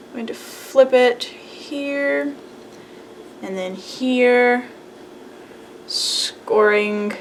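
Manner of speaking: ordinary talk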